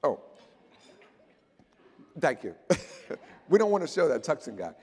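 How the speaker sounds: usual speaking voice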